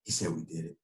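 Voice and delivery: low, fading, choked-up mock; lightly voiced teammate, words dropping away soft; teary friend. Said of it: "starting to cry a little bit".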